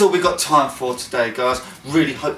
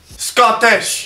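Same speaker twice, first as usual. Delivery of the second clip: put-on Scottish accent; 7 semitones higher than usual. Naming the chosen challenge accent, mock Scottish. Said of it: "My accent is".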